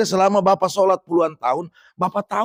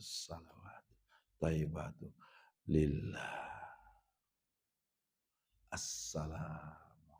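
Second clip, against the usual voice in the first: slow, softer whispered recitation, drawn out on each breath; slow breathy whisper; 11 semitones lower, 19 dB quieter, slower than usual. Shown as a demonstration. Preacher shows the congregation how to recite with slow breaths, whispered. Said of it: "Tarik nafas".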